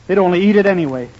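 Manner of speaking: usual speaking voice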